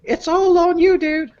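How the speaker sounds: put-on high falsetto girlfriend voice reading texts; girly voice. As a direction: read her lines in a squeaky girly voice. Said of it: "girly voice for this part".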